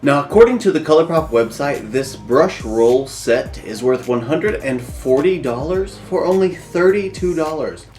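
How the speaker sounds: ordinary delivery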